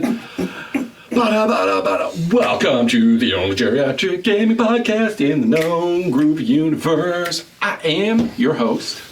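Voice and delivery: high, drawn-out mock announcer welcome; show announcer intro. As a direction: belt out a hammed-up podcast opening.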